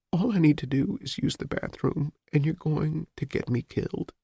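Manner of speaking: high, quiet despair; hopeless. Acted. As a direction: give up inside as you say it. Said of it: "she said hopelessly".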